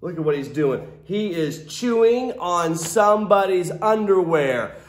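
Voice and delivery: ordinary delivery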